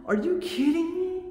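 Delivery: high, sharp are you kidding me with the push on you; exasperated disbelief. Real, not acted. Venting about the power outage.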